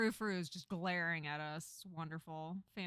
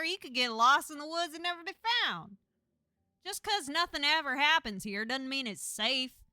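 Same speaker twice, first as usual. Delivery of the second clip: loud, higher-pitched bossy lecture; exasperated, emphatic family telling-off; scolding cousin; 4 semitones higher, 7 dB louder than usual. Acted. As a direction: lay down the law to a wayward cousin.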